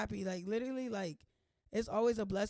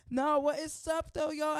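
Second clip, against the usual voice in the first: higher, louder, drawn-out hello; excited greeting; 6 semitones higher, 6 dB louder than usual. Real, not acted. Vlogger greeting viewers she missed, excited. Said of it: "wasn't gonna say that intro like that".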